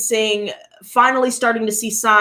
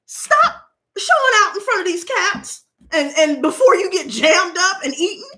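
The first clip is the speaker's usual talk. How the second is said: mild motherly scolding; 8 semitones higher than usual. Retold or imitated. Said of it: "So I said".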